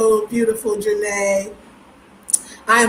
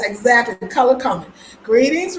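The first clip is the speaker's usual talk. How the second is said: usual speaking voice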